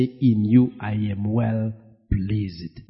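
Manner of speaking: normal talking voice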